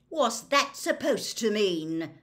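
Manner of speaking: loud, slow, offended demand; angry challenge. A narrator's reading. Godfather challenging his rival's insult, angry. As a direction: rise to the taunt. Sirius angrily challenges Snape's taunt.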